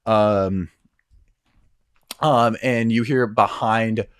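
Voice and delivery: ordinary talk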